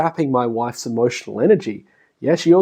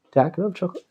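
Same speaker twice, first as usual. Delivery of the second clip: put-on kid asking; 4 semitones higher, 6 dB quieter, much faster than usual. Child then asks dad instead.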